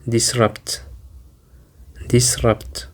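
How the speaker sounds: disrupt said dis-RUPT, weight on RUPT; stress on second syllable. Shown as a demonstration. Teacher introducing the new word, dis-RUPT. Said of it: "the stress is on the second syllable".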